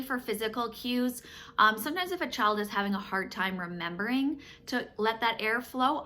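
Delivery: ordinary delivery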